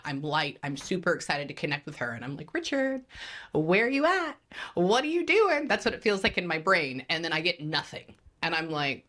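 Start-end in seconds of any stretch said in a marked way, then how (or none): 2.5-5.7 much higher, louder, bright eager calling out; happy high calling. Retold or imitated. Medium retelling her first happy call to the dead father, high excited calling. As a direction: call out to the spirit, excited and light. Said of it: "pinging at, like, the highest 'cause I'm happy".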